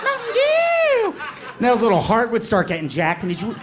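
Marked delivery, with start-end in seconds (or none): 0.0-1.1 very high, slow wild cry; hyped squeal. Retold or imitated. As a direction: scream it like a sugar-rushed kid. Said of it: "He'd be like".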